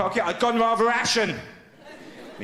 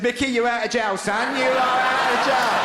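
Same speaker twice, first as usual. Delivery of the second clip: gleeful relief; 6 semitones higher, 3 dB louder than usual. Retold or imitated. Gleeful self-talk, second plane.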